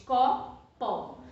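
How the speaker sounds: "Copo" in two separate beats, co, po; syllable by syllable. Shown as a demonstration. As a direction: open the mouth twice for "Copo": co, po. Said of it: "abre a boca pra falar".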